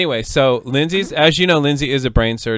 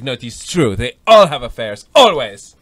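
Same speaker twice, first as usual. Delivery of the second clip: put-on insistent French accent, building louder; 6 dB louder than usual. French wife contradicting her husband about affairs, insistent French accent. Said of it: "That's my French story".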